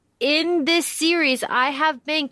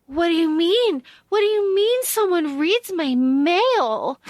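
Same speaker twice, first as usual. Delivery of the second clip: mock Juliet; 5 semitones higher than usual. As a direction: poke fun at Juliet's naive disbelief. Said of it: "when Juliet's like".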